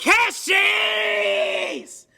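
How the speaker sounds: high, loud anguished cries of no; anguished yelling. Real, not acted. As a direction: yell in dismay as the speaker dies. Speakers blow up, yelling.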